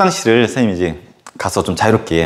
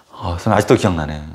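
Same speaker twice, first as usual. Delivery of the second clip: ordinary talk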